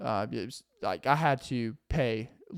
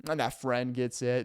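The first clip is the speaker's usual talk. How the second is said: ordinary talk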